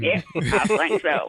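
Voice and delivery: relaxed Southern accent. Shown as a demonstration. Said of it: "real big jaw movement".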